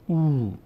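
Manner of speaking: او held as a long "ooo"; stretched oo vowel. Shown as a demonstration. Teacher showing zamme turning long, stretched "oo" on او. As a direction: draw the final او out as oo.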